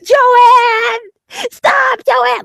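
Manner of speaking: shrill falsetto scream of a man losing it; mock high scream. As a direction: shriek it in a squealing high voice. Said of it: "He's like".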